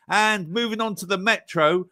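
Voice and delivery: normal talking voice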